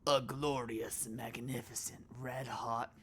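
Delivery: slow, slightly put-on grand voice; a tentative stab at a grand announcer; first go at the big voice; first try at the voice. Shown as a demonstration. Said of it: "No, that's not right".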